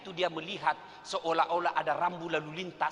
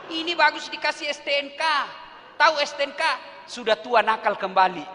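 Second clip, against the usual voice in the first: cheeky retort; 8 semitones higher, 8 dB louder than usual. Retold or imitated. Old woman retorts boldly.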